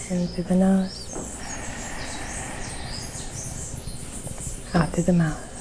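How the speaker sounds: measured breathing cues, long silences between phrases; guided meditation. Real, not acted. Guide leading listeners through nose and mouth breaths, guided meditation.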